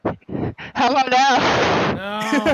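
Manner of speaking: very loud, blown-out mic; drawn-out blast right into the microphone; too close to mic. Real, not acted. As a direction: overload the mic as a sound check. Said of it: "Now you're too close".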